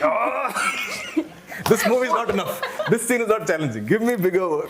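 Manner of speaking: high-pitched, laughing mock complaint; mock dissatisfied star. Teasing impression of a talented co-star wanting bigger work, mock dissatisfied. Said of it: "she'd be like".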